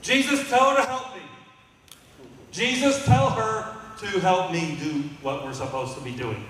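high, pleading complaint to Jesus; whiny Martha. Retold or imitated. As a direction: whine it like put-upon Martha.

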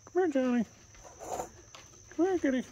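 very high coaxing call; high pet call. Real, not acted. Owner calling Johnny and kitty over, high-pitched coaxing. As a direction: beckon the animals in a high voice.

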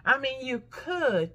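ordinary delivery

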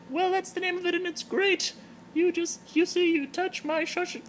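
Terry the giraffe. Acted. Terry the giraffe greeting her blog fans in falsetto. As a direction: greet the fans like a tipsy celebrity in a high voice.

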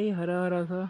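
ordinary talk, nothing marked

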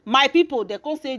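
ordinary talk

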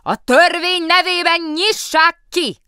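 very loud, high, slow roar; yelled from the cell. A narrator's reading. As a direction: bellow through the cell door. Kask keeps yelling from cell.

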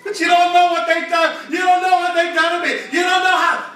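loud, high, wounded protest; aggrieved church member. Acted. Preacher voicing a wronged member, loud aggrieved protest. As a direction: play the hurt member insisting. Acted complaint of a hurt member.

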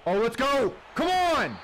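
high, loud celebratory yell; excited shout. Real, not acted. Gamer celebrating a completed play, excited shout. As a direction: yell it as the big play lands.

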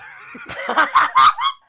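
high, loud shrieking laugh. Real, not acted. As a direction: squeal with laughter.

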